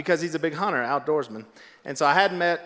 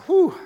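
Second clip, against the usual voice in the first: high, louder whoop of relief; relieved whew; 13 semitones higher, 5 dB louder than usual. Retold or imitated. Speaker hiding in the stairwell escapes notice, relieved whew. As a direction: let out a high mock "whew". Mimes relief at not being called.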